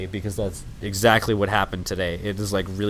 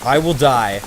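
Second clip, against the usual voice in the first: ordinary talk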